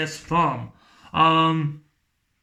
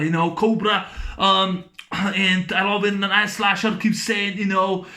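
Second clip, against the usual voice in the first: ordinary talk, nothing marked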